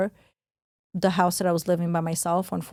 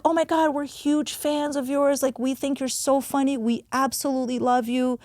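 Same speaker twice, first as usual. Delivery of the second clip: gushing fans; 6 semitones higher than usual. Retold or imitated. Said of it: "we're like".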